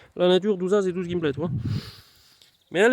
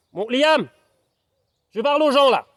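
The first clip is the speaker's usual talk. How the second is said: louder and higher, shooing the dog away; telling off the dog; 6 semitones higher, 3 dB louder than usual. Real, not acted. Scolding his dog because he's talking to viewers, loud. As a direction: stop the dog, sharply.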